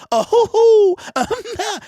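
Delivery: high, loud giggly mock laugh; giggling Brad Holmes. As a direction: squeal like someone being tickled. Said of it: "And he gonna say".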